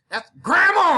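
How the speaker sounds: high, loud shout; exasperated shout. Real, not acted. Kid yelling as grandma walks into the shot, exasperated shout.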